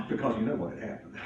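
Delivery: ordinary delivery, nothing marked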